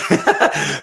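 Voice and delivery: real high-pitched laugh; embarrassed laugh. Real, not acted. Trainer swore in front of his nine-year-old daughter, embarrassed laugh. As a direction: burst out laughing at your slip.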